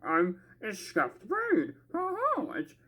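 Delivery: ordinary talk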